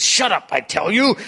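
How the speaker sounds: high, loud snarl. Acted. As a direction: snap it with impatience. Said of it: "with a snarl of impatience".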